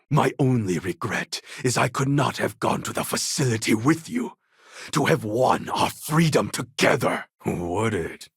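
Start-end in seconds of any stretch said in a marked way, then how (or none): none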